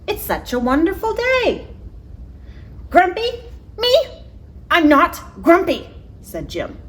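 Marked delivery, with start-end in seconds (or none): 0.1-1.7 much higher bright animal voice; cheerful Marabou. Acted. Marabou cheering up Jim, high-pitched and cheerful. 2.9-5.9 much higher, louder, outraged; indignant denial. Acted. Jim indignantly denying he is grumpy.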